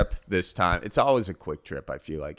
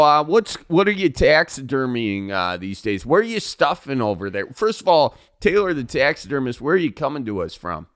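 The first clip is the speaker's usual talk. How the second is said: folksy radio host, pitched higher; Northwoods radio host; 4 semitones higher than usual. Acted. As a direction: welcome listeners like a backwoods radio show host. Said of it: "I'm the host of Northwoods Radio".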